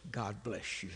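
soft, low, calm blessing; calm wife. Retold or imitated. Calm wife answering his complaints, soft and low. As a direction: say it gently, like nothing bothers you. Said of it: "She just says".

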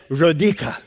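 imitated Romanian accent on the name. Grandmother telling a stranger her name, Romanian accent. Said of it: "she says in this unique accent".

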